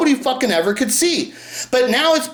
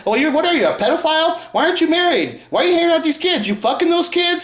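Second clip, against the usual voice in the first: higher, mock scandalised accusations; accusing public; 7 semitones higher, faster than usual. Everybody accusing Michael over the kids, mock scandalised high voice. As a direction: pile on like a gossiping crowd. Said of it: "And everybody's like".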